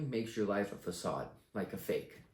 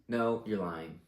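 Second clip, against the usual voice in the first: ordinary talk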